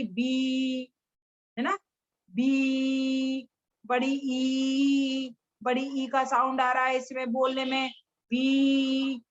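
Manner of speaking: stretched बी held long. Shown as a demonstration. Teacher stretching बी for children to hear. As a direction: hold the बी sound out. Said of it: "बी में कितना ऊंची आवाज आ रही है".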